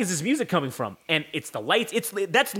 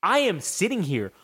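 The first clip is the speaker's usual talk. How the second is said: ordinary talk, nothing marked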